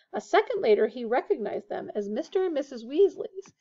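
ordinary delivery, nothing marked

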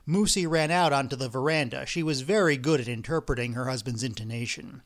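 ordinary delivery, nothing marked

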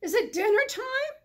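high, loud bellow; bellowing sheep. Acted. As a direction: call it across the hillside. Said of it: "he bellowed".

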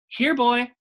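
high, loud call to a dog. Acted. Opal calling the dog across the store, high loud call. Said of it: "I said again".

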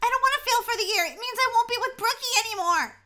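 high, loud worried whine; distressed pleading. Acted. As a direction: fret out loud, close to panic. Frets about failing the year.

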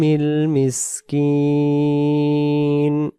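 slow, drawn-out melodic chant; Quran recitation. Shown as a demonstration. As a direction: recite it slowly with long held vowels. Continues the recitation.

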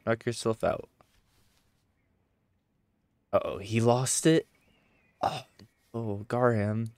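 ordinary delivery

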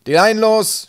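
high, loud deck command; shouted order. A narrator's reading. Captain at the wheel ordering departure, shouting. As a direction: yell it across the deck. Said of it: "schrie er".

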